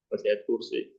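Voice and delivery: laughing softly through the words. Shown as a demonstration. Preacher repeats his joke about reciting the verse, laughing. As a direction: chuckle it out quietly.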